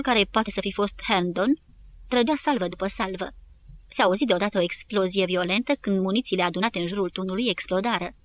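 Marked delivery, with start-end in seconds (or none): none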